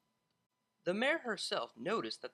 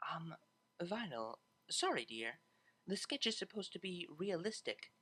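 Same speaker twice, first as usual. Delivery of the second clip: gentle interruption; 6 dB quieter than usual. A narrator's reading.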